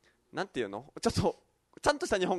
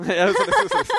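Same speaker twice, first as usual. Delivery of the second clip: much higher, louder, laughing take-back; laughing retraction; 7 dB louder than usual. Real, not acted. Teasing host retracts jab while laughing hard. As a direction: laugh it off as a joke.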